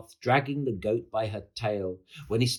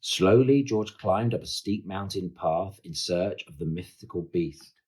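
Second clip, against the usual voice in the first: ordinary delivery, nothing marked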